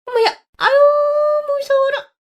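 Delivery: exaggerated frightened yelp; startled mock fright. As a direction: squeal as if scared, but mocking. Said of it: "화들짝 놀란".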